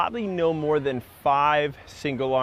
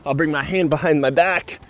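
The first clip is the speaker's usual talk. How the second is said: ordinary talk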